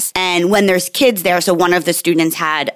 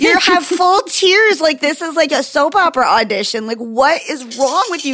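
mock exasperation; 9 semitones higher than usual. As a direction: act stunned at the kid, laughing. Mother's mock exasperation retold.

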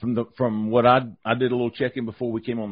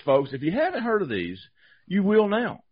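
normal talking voice

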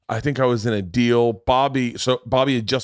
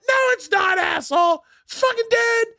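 imitated high-pitched indignant yelling; furious Bobby. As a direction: argue back in a hammed-up squawk of outrage. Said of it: "fucking furious".

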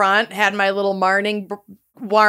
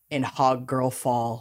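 dramatic hush; 10 semitones lower, 9 dB quieter than usual. Acted. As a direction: drop low and quiet. Dramatic low reveal.

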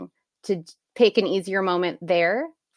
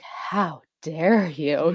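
slow, drawn-out outraged mom; monster voice; 2 semitones lower, much slower than usual. Retold or imitated. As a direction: stretch each word out with mock menace. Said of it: "my monster voice comes out".

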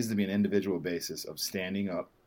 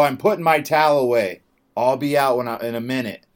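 raised reply; 6 semitones higher, 11 dB louder than usual. Retold or imitated. Retold reply to yelling attendant.

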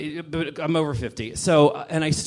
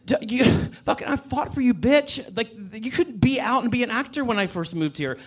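higher, impassioned outburst; heated rant; 6 semitones higher than usual. Real, not acted. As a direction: vent at the whole world. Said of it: "That was a wonderful rant".

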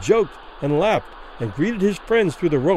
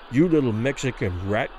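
low, menacing hiss; hissed insult; 6 semitones lower than usual. A narrator's reading. Boxer to his opponent, unheard by the audience, hissing. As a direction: slip the insult out low through a smile. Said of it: "hissed from between Danny's gaily smiling lips".

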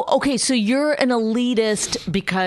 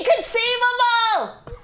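higher, slow, silly inconceivable; mocking impression. Mock quote in silly voice.

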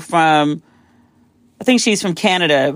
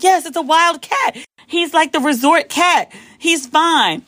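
higher, slow imitation of a patient guide; reassuring guide; 9 semitones higher, slower than usual. Resort guide reassures startled guest, lightly imitated slow patience. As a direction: lightly voice someone explaining slowly to a guest.

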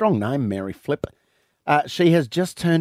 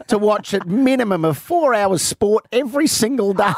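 put-on old prospector; 7 semitones higher than usual. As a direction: play a doddery old codger. Playing a hundred-year-old man.

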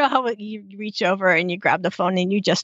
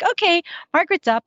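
brisk maid; 8 semitones higher, faster than usual. Retold or imitated. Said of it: "It's like".

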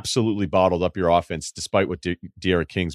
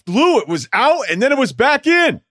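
worked-up retelling; 7 dB louder than usual. Retold or imitated. Fan tells the coach how the kick went, high and insistent. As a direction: push it up high like a wronged fan. Himself retold arguing the kick.

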